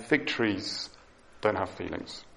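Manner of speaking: "fig trees" slowed and "don't have feelings" dropped low; slow emphatic statement. Shown as a demonstration. Preacher restating the point to congregation, slowly. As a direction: drive the point home slowly. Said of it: "It's very important".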